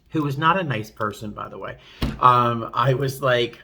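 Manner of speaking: normal talking voice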